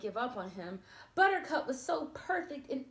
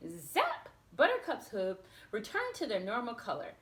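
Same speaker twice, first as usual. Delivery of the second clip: plain reading voice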